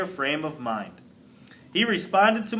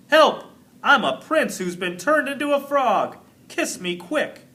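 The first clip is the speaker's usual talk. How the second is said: high, loud mock plea for rescue; mock cry for help; 9 semitones higher, 4 dB louder than usual. Teacher reads the joke note as a frog's plea. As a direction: ham up the frog prince's plea.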